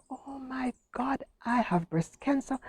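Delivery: breathless whisper of dread; whispered realisation. Retold or imitated. Woman alone at home grasping her diagnosis, whispered. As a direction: whisper it to yourself in shock.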